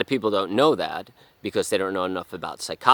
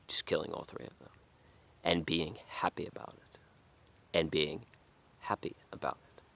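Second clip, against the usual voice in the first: menacing whisper; 2 semitones lower, 11 dB quieter than usual. Retold or imitated. Narrator confessing his violent fantasy, eerie whisper. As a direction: breathe it like a dark secret.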